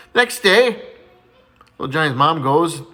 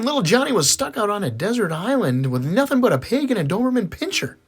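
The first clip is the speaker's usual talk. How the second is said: usual speaking voice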